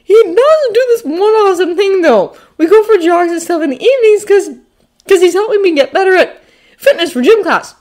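put-on high, loud boyish rambling; excited bragging. A narrator's reading. Peter excitedly brags to Ned.

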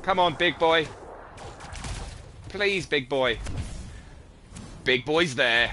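will it to happen; loud coaxing. Real, not acted. Coaxing loudly for a catch.

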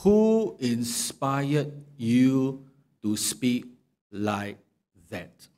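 very slow, deliberate, fading to a hush; pointed question. Acted. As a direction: drag it out, homing in on him. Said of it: "That's why Job questioned him".

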